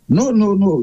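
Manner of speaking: usual speaking voice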